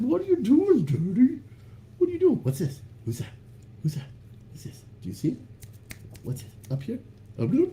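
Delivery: very high squeaky puppy voice; dog's inner voice. Retold or imitated. Voices the scared dog asking Daddy, very high. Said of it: "He's like".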